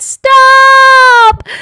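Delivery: long, high all-out yell; yelling as loudly as possible. A narrator's reading. Taya picked up by the ear, yelling her loudest. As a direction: yell it with everything you have. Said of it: "yelled as loudly as she could".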